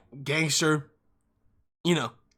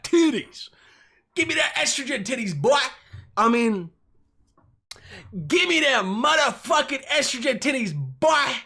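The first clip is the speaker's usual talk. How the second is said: much higher, whiny hammed-up plea; hammed-up high-pitched begging; mock demand; 9 semitones higher than usual. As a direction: beg it like a silly chant.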